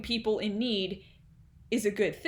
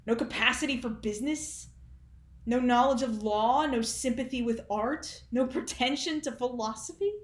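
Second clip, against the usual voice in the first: higher, incredulous sarcastic build, swinging in volume; Undershaft, mocking father; 6 semitones higher than usual. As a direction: scoff in mock amazement at your son. Performs Undershaft monologue, mocking son.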